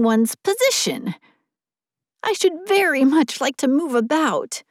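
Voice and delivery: high, eager snowman voice; chattering melting snowman voice. A narrator's reading. Snowman speaks on.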